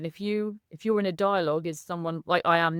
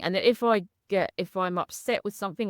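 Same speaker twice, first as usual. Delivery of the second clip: usual speaking voice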